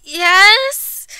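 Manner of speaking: high, rising, stretched yes; sheepish squeak of admission; confessing child. A narrator's reading.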